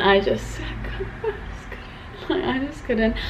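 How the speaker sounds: very soft, words almost failing; real emotion swallowing the words; emotional. Real, not acted. Vlogger recalling goodbye to Dad, emotional and hushed. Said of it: "It was a very emotional day".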